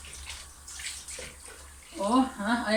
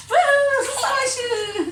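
high, loud whoop then a slow cheer; excited whoop; 10 semitones higher, 4 dB louder, slower than usual. Real, not acted. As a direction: whoop with delight at the splashing. Water fight cheering.

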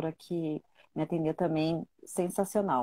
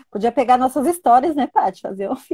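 higher and louder, bursting with the idea; eager pitch, voice climbing and loud; excited; 6 semitones higher, 8 dB louder than usual. Real, not acted.

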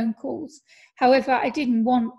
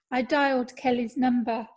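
ordinary talk

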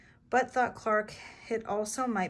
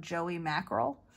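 ordinary delivery, nothing marked

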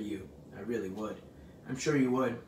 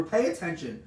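raised, urgent order, slightly contained; scream; 5 semitones higher, 4 dB louder than usual. A narrator's reading. Pig orders dazed rat to listen, mild scream. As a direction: order him sharply to attend. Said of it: "screamed Wilbur".

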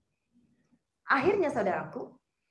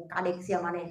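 voice of God; 6 semitones lower than usual. Retold or imitated. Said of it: "kata Tuhan".

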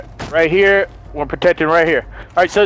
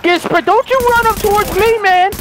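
excited yelling; 11 semitones higher than usual. Real, not acted. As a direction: yell through the firefight. Firefight, yelling at enemies.

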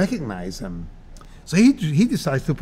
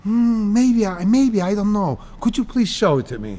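hesitant and higher, easing into a polite request; humble uncertainty; 6 semitones higher than usual. Shown as a demonstration. Advising how to answer "do you know this", humbly unsure. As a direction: model feigned doubt, then ask nicely. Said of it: "Don't be a wise guy. You know, just say".

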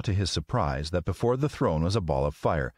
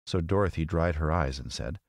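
plain reading voice

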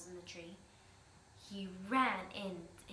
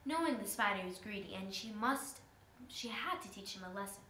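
plain reading voice

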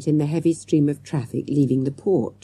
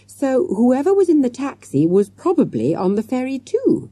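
ordinary delivery

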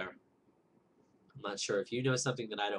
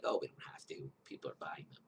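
mock whisper; 6 semitones lower, 9 dB quieter than usual. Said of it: "but they're like".

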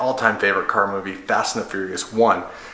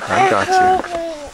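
tender; much slower than usual. Real, not acted. Dad soothing sleepy toddler.

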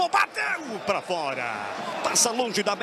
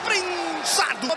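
grito de lance; 5 semitones higher, much slower than usual. Real, not acted. Chute bloqueado na área.

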